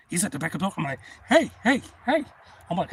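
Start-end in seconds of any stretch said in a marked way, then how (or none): 1.3-2.2 loud, high repeated hails; retold urgent calling. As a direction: act out trying to get his attention fast. Repeated calls to man in danger.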